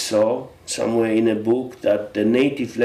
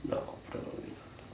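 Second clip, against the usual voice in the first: quiet aside; 19 dB quieter, much faster than usual. Real, not acted. Quick self-correcting aside.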